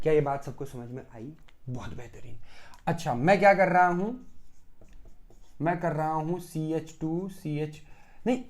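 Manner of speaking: usual speaking voice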